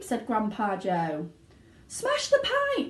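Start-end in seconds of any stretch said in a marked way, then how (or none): 1.9-2.9 very high, slow frantic yell; yelling mother. A narrator's reading. Mother yelling at the crowd, very high. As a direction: yell the order. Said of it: "yelled Missus Gloop".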